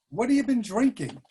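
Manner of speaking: loud question. Retold or imitated. Old man calls to drunk.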